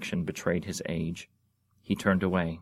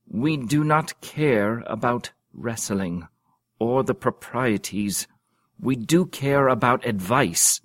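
slow, commanding royal pronouncement; kingly voice; 4 semitones higher, 6 dB louder than usual. Acted. Young king rebuking his quarrelling dukes, slow and regal. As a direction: speak down from the throne, measured. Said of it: "in his most kingly voice".

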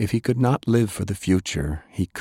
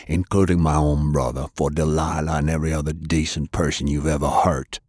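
low, grim vow to kill; vengeful threat; 4 semitones lower than usual. A narrator's reading. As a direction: lower voice, swearing revenge. Sullivan swears revenge.

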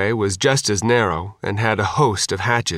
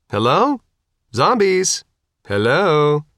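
raised voice calling into darkness; 6 semitones higher, slower than usual. Acted. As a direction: call out to find anyone. Calling into the ship.

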